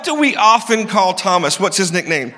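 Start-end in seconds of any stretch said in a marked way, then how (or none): none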